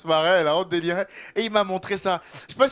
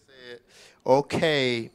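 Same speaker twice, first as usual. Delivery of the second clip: ordinary talk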